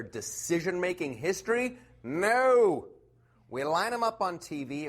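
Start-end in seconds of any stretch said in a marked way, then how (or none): 2.0-2.9 high, loud flat no; a sharp mock-indignant no; emphatic no. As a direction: shoot the idea down. Rhetorical answer.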